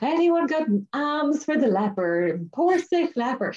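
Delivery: high, slow, pleading beggar voice; pitiful leper. Retold or imitated. Leper begging the zombie-fighters for arms, high slow plea.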